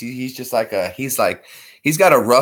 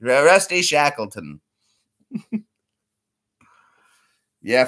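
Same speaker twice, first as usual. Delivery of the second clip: ordinary talk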